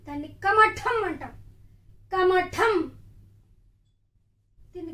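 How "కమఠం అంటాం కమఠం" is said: కమఠం louder with the aspirated ఠ pressed; emphatic teacher model; emphatic new word. Shown as a demonstration. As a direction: stress కమఠం, pushing ఠ firmly. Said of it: "తాబేలు అంటాం".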